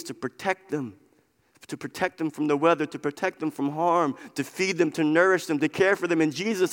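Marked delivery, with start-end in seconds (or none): none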